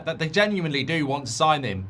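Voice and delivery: normal talking voice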